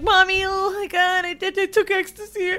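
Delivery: put-on high, giddy childlike voice calling mommy; a giddy kid blurting it out to mom; giddy teen blurting to mom; high teen confession. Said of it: "And I was like".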